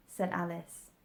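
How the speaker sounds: ordinary delivery, nothing marked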